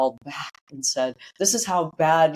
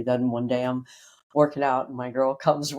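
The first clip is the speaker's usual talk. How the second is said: ordinary delivery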